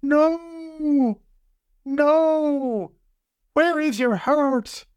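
very high, long drawn-out wail; crying out. A narrator's reading. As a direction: beg in a thin high voice, stretching each word. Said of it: "the spell cried out".

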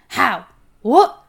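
loud, bewildered blurts; dazed questions. A narrator's reading. Dwarf stumbling along behind, dazed and bewildered. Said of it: "Dazed, Gravelbeard was stumbling along".

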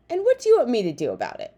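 fast, loud, high snap; irritated snap. A narrator's reading. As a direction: snap back, brushing them off. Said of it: "she snapped".

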